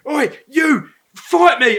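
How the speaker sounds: retold belligerent challenge; drunk challenger. Drunk bloke to Tom at the bar, mock aggressive yell.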